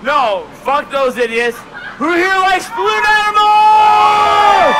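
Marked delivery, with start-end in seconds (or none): 2.0-4.8 slow, loud crowd question; loud showman call. Real, not acted. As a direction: bellow it to the whole room.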